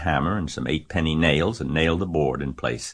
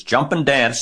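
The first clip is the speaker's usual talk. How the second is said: high, loud call; rooster calling out; 9 semitones higher, 6 dB louder than usual. A narrator's reading. Rooster advises fleeing Wilbur, calling out. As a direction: call it out, voice pitched up. Animals shout advice to fleeing pig.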